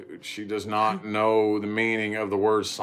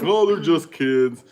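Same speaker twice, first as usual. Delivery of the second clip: mocking dismissive; 5 semitones higher, 7 dB louder, slower than usual. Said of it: "everybody's like".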